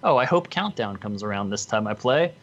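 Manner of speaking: ordinary talk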